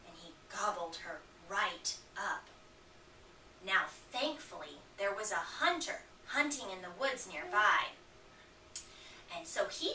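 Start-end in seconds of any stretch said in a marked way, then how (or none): none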